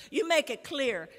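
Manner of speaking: usual speaking voice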